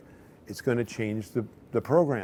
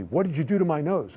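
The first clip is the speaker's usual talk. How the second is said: raised-pitch indignant demand; retold confrontation; 6 semitones higher than usual. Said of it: "she comes marching down".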